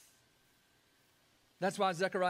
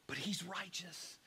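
hushed whisper of awe; 3 semitones lower, 9 dB quieter than usual. Real, not acted. Preacher to congregation, whispering. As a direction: drop to a whisper to make it land.

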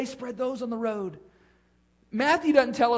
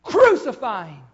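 crowd's cry; 11 dB louder than usual. Retold or imitated. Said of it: "they said with one voice".

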